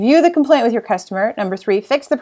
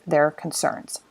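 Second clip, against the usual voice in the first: whisper; 6 semitones lower, 9 dB quieter, slower than usual. Real, not acted. Trailing aside.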